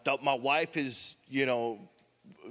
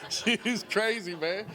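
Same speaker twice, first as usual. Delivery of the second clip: laughing; 7 semitones higher, slower than usual. Real, not acted. Laughing about his daughter.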